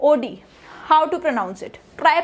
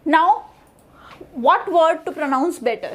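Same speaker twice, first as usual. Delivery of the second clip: ordinary delivery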